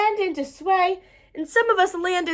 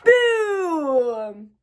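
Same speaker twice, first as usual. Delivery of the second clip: shouted boom. A narrator's reading. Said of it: "shouted a little gray mouse".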